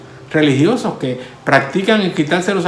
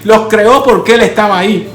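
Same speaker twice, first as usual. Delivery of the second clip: loud, higher, full-force pulpit proclamation; fiery preaching; 5 semitones higher, 3 dB louder than usual. Acted. Preacher proclaiming to the congregation that God exists, at full force. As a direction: thunder it out to the congregation.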